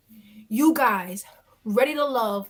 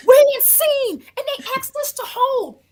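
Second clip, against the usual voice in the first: high shout; 12 semitones higher, 8 dB louder than usual. Retold or imitated. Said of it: "woman calling out".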